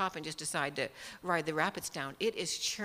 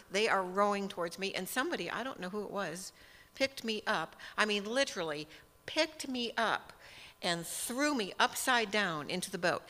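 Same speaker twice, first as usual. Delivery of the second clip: normal talking voice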